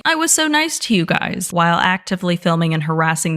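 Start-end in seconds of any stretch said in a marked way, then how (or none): 0.0-1.5 high mocking quote of the customer; mock whiny customer. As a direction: imitate him, pitched up and whiny. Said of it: "quoting him mockingly".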